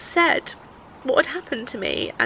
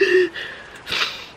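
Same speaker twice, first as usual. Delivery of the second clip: panicked gasp; 8 semitones higher than usual. Retold or imitated. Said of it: "I was just like".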